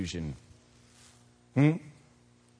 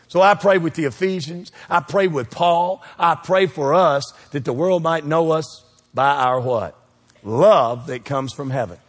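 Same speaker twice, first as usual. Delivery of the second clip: ordinary talk, nothing marked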